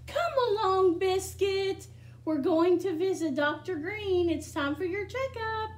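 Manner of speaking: bright, lively picture-book reading; reading to children. Acted. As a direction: read it to a room of small kids. Reads picture book aloud.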